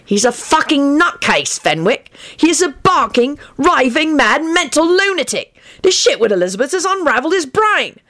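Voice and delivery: high-pitched, exasperated rant; worked-up tirade, high; angry rant. A narrator's reading. Sirius rants in the quarrel.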